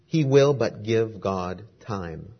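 slow and deliberate, each word placed; slow, weighty counsel. Acted. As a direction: let the advice sink in slowly. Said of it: "exclaimed the minister".

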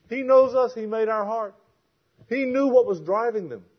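high, loud, insistent sermon delivery; forceful preaching. Real, not acted. Preacher warns listeners never to say nobody understands, impassioned preaching. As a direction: drive it home to the congregation, pitched high.

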